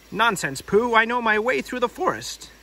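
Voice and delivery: huffy Rabbit; indignant Rabbit voice. Acted. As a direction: brush off the silly idea.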